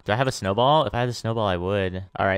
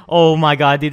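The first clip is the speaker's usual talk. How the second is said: much louder, thrilled outburst; excited exclamation; 4 semitones higher, 9 dB louder than usual. Real, not acted. Gamer thrilled as his trap works again, loud outburst. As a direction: blurt it out in delight.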